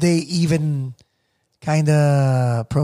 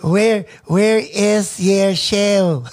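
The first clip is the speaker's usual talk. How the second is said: put-on slow, higher Eagle Rock Filipino accent, drawn out; 4 semitones higher, much slower than usual. Imitating Filipinos overheard at the plaza, Eagle Rock accent. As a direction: stretch the vowels flat, Eagle Rock style. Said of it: "because I would hear them".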